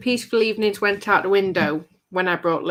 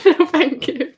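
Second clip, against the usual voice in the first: laughing refusal; 7 semitones higher, 5 dB louder, slower than usual. Real, not acted.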